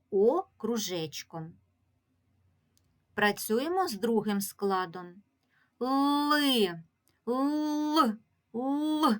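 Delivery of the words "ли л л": long held л in Ли, then л alone, higher; isolated, stretched л sounds; drawn-out consonant. Shown as a demonstration. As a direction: hold the л on a raised note. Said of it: "Чуємо звук Л".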